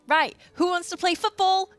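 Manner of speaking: rallying everyone for a game; eager recruiter. Acted. Raid player asks the group who wants football, eager call-out.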